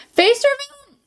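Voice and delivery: high-pitched mock exclamation; high squeaky echo. Echoes chat in high voice.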